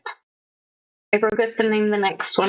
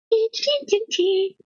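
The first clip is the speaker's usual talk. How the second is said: high squeaky voice; 14 semitones higher than usual. Acted. Repeat take of squeaky line.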